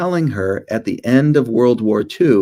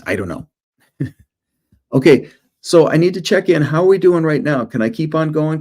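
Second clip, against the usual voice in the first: normal talking voice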